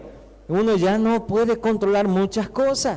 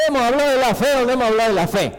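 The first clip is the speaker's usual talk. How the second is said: rapid, higher-pitched scolding; quick acted reproach, pitch raised; Jesus; 5 semitones higher, 3 dB louder, much faster than usual. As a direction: rattle it off as an exasperated reminder. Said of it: "Como que algo así le dice Jesús".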